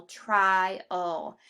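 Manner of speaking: TRIAL stressed on TRI, drawn out as "TRY-ul"; first-syllable stress. Shown as a demonstration. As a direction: lean on the first syllable of TRIAL, let the second drop. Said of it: "an accent in the first syllable".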